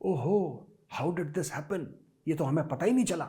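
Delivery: soft, mock-innocent whine of surprise; mock whining. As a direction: play the clueless whiner, hushed. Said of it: "बाद में चू चू मत करना".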